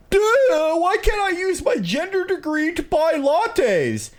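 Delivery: whiny millennial. Retold or imitated. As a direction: whine it like a dim millennial. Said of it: "Here's my impression of a millennial".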